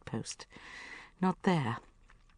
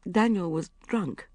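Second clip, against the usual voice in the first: ordinary delivery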